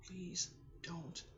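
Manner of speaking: halting words with long pauses, sliding into whisper; fighting back tears. Real, not acted. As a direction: plead with yourself not to cry, breath giving out. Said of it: "to cry".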